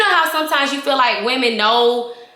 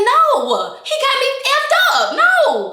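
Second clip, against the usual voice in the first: hollering; 14 semitones higher than usual. Retold or imitated. Fighting off her friends, hollering high and fast. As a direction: blow up, shouting high. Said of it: "So I'm hollering".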